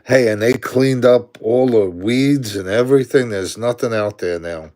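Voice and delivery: ordinary talk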